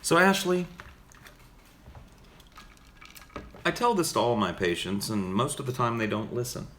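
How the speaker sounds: lightly voiced therapist addressing a patient; measured counselor talk with deliberate pauses; psychotherapist. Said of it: "Do a psychotherapist?".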